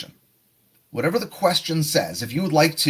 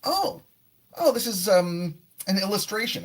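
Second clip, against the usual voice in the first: high, light mock surprise starting an answer; 6 semitones higher than usual. Shown as a demonstration. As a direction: sound like you just noticed the picture. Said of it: "But if we start with".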